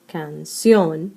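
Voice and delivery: "canción" split, with CIÓN stressed and drawn out; stress on last syllable. Shown as a demonstration. Teacher explaining why canción has an accent, stress on CIÓN. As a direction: hold back "can", then land on CIÓN in "canción". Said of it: "the strong syllable is the last syllable".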